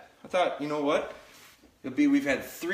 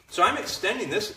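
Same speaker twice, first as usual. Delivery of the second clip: ordinary delivery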